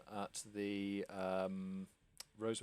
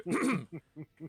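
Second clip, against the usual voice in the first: loud affronted throat clear; lordly harrumph; pompous throat clearing; 7 semitones higher, 8 dB louder than usual. Acted. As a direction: clear the throat before correcting her. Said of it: "put out and go".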